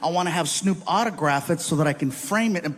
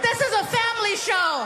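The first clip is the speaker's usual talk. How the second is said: much higher mocking prissy voice; mock prim audience; 13 semitones higher than usual. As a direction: squeak it like a scandalized parent. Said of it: "Everybody's like".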